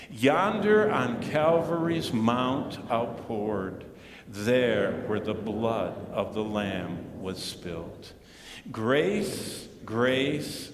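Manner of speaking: slow, measured hymn reading; congregational recitation. Acted. Pastor leading everyone in reading the hymn, slow recitation. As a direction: recite in drawn-out phrases for a group to follow.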